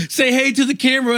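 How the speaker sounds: quick, high-pitched taunt; lightly acted goading, said fast; taunting driver. Driver goads passenger with dash camera, taunting.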